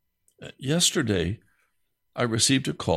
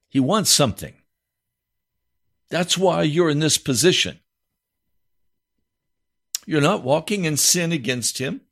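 raised, fervent sermon voice; full-force preaching; 6 semitones higher, 3 dB louder than usual. Acted. Pastor preaching hard to his listeners. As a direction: drive the point home to the congregation.

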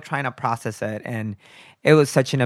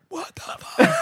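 hushed disbelieving whisper; under the breath; slower than usual. Retold or imitated. Whispering in shock to husband beside her, hushed. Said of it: "under our breath".

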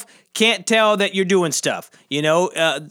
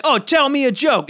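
demanding; 6 semitones higher than usual. Retold or imitated. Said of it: "It's always like".

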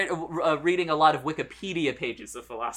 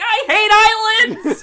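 very high, loud, drawn-out mock outburst; tantrum yell; 14 semitones higher, 8 dB louder, slower than usual. Producer refusing to work on set, yelling. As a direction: wail it like a comic tantrum.

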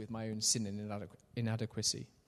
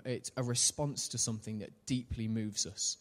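ordinary talk, nothing marked

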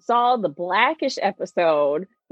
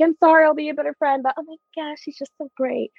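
imitated gushing; 9 semitones higher, faster than usual. Voices girl gushing about new girlfriend.

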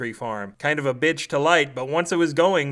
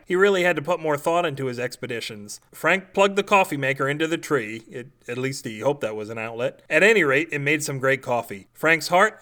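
ordinary delivery, nothing marked

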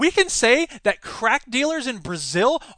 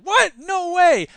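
much higher, louder what-no-way; mock disbelief; 11 semitones higher, 7 dB louder, much slower than usual. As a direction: act floored by the headline. Reacting to surprising headline.

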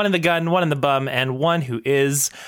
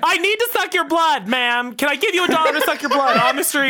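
high, quick campy pleading; campy Dracula; 10 semitones higher than usual. Pretend. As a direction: beg a stranger like a diva. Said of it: "Dracula's voice".

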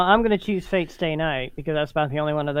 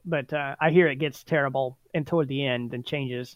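ordinary talk, nothing marked

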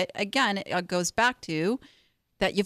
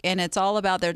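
ordinary talk

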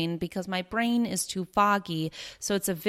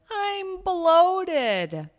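much higher and louder, a wailing I'm bloated; dramatic complaint; 11 semitones higher, 5 dB louder than usual. Shown as a demonstration. Showing the drama she wants viewers to drop, loud mock moan. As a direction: moan it like a tragedy. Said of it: "rather than".

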